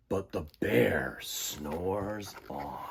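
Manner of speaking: slow, low, hushed refrain; storybook refrain. Acted. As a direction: let it settle like the bear sleeping.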